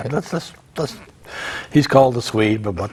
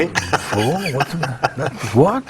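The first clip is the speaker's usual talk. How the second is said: put-on southern accent; 12 semitones higher than usual. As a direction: ham up a southern yokel. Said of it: "did it in a southern accent".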